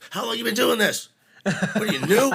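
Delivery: loud, high exasperated telling-off; exasperated mock yell, half laughing; retold yelling. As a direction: imitate yelling at an incompetent crew. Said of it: "we yelled for a minute".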